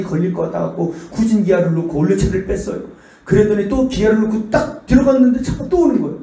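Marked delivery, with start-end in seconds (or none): none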